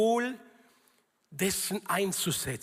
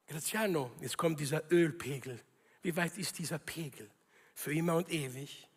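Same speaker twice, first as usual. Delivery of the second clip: low, soft personal address; voice of God; 6 semitones lower, 6 dB quieter than usual. Acted. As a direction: drop low and quiet to question one person. God checks Graziano's oil level again. (tiefe, leise Stimme Gottes)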